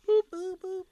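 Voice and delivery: high cartoon flapper voice; sing-song cartoon boop; Betty Boop. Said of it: "She also did Betty Boop".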